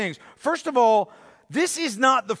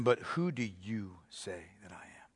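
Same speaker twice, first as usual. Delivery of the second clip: whispered question; 13 semitones lower, 15 dB quieter than usual. Acted. Preacher voicing Jesus asking his disciples, whispered.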